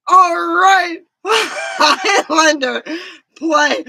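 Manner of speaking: loud, high imitation of an eager dad; excited dad. Daughter imitating dad starting the Highlander tape, excited shout. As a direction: announce it big, like dad starting the show. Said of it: "My daddy goes".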